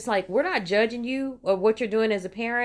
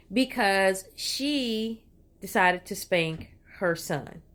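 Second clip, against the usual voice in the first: ordinary talk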